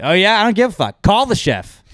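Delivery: hammed-up angry brush-off; mock dismissive rant. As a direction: shout it like you're done with them. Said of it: "which is basically just like".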